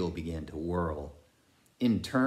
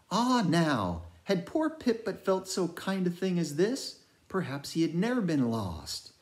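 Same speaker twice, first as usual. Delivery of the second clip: put-on wondering boy Pip; 8 semitones higher than usual. A narrator's reading. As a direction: marvel at the kind hand like a lost child. Pip's voice, tender and wondering.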